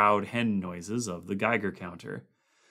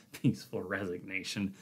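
soft mirthless laugh; 7 dB quieter than usual. A narrator's reading. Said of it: "Conant laughed softly, mirthlessly".

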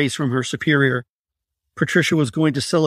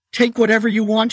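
high, breathless, frightened gasp; gasped plea; 8 semitones higher, 3 dB louder than usual. Acted. Patricia to her abductor, a terrified gasp. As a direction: gasp it out in terror, pitched up. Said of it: "she gasped".

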